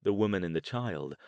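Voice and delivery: plain reading voice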